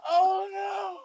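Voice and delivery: laughing protest. Real, not acted. Reacting to the joke.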